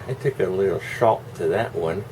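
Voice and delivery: usual speaking voice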